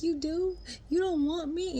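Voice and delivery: very high, loud tearful imitation; mock crying. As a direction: plead like a hammed-up weeping woman. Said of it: "she's crying, like, crying".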